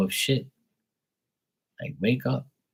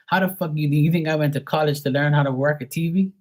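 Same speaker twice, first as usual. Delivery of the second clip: high-pitched, indignant question; exasperated retort; 7 semitones higher than usual. Retold or imitated. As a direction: throw it back at them in disbelief. Acted retort to parents over TV.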